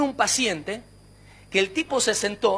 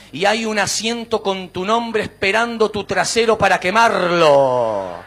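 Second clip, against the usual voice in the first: low, loud mock doom-monger; hellfire preacher; 4 semitones lower, 6 dB louder, slower than usual. How not to invite people to church, mock hellfire preaching. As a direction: draw out each threat in a hammed-up low roar.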